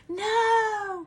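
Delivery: high, drawn-out scolding no; mock yelling. Owner scolding dog on the bed, mock yell. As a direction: yell a long, high-pitched no. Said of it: "I'll do the yelling and screaming".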